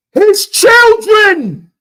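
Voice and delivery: very high, drawn-out yell; loud, high holler, stretched out; shouted answer. Real, not acted. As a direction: bellow the obvious answer slowly. Shouts the answer to his question.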